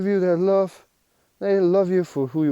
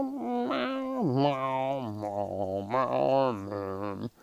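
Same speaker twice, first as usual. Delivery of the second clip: soft imitated meows, sinking lower; meowing cat; 4 semitones lower, 8 dB quieter, much slower than usual. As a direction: purr out gentle cat meows. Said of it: "cat comes to you next moment and meow".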